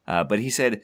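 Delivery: ordinary delivery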